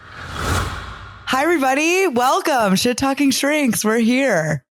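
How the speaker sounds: bright, high host greeting; podcast intro. Acted. Host opening the show for everybody, a high upbeat greeting. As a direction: welcome the listeners in with energy.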